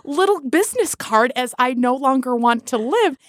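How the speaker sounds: high-pitched, laughing incredulity; amused disbelief. Real, not acted. Laughing disbelief at the absurd card.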